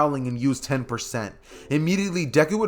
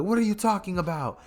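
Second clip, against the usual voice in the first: higher, raspy, baffled question; weird raspy voice; 6 semitones higher than usual. Acted. Naruto asking Deku, in a weird raspy voice. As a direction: squeeze it out in a weird rasp. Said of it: "he'd do that weird raspy voice".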